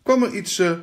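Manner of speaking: usual speaking voice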